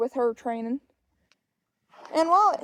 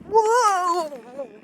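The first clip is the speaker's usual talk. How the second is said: startled whoa; 6 semitones higher than usual. Real, not acted. Tripping while vlogging on a walk, startled slow whoa. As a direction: cry out as you nearly trip. Stumbles while walking and talking.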